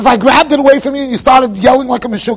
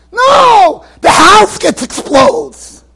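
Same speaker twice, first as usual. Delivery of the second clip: loud outburst; 7 semitones higher, 5 dB louder, much slower than usual. Acted. Baby refusing to hold it.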